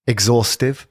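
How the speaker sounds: normal talking voice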